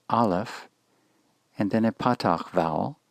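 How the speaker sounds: usual speaking voice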